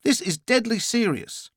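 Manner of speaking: high-pitched, earnest urging; insistent. A narrator's reading. Caller insists after cutting in.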